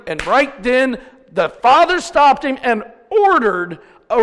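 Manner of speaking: loud, high, drawn-out proclamation; full-force preaching. Acted. Preacher telling of the father spotting his son, proclaiming. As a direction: preach it out, voice lifted and stretched.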